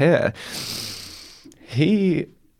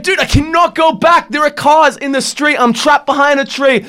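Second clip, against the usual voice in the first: exasperated protest; 10 semitones higher than usual. Retold or imitated. Driver shouting back that he is trapped by the tree, exasperated.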